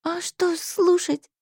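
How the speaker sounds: mild sob. Acted. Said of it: "всхлипнула я".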